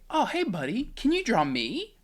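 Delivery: put-on high, bright coaxing to a toddler; sing-song parent voice. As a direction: coo it like talking to a two-year-old. Sweet talking to toddler.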